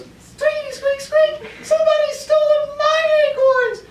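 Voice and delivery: very high, squeaky and loud squirrel cry; panicked squirrel. Acted. Teacher voicing a robbed squirrel to students, very high squeal. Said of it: "Then Caitlin goes".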